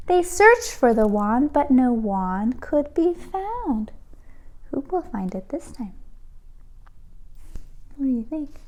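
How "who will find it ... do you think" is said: quick, secretive whisper. A narrator's reading. Narrator asking the children, in a fast whisper. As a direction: lean in and whisper the question.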